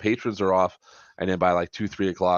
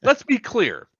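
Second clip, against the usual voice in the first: bitter rant; 11 semitones higher, 5 dB louder than usual. Real, not acted. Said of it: "somebody's a little bitter".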